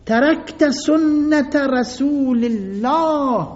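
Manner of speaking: high, slow, drawn-out cry; retold shout. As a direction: call out slowly, raising the voice in reproach. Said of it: "از همونجا داد زد".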